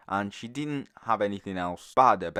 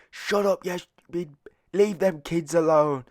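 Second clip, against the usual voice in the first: high, put-on telling-off; mock angry scolding; 7 semitones higher than usual. Man telling the woman to leave kids alone, mock anger. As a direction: imitate an irate man, dragging out the last words.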